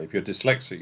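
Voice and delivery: ordinary delivery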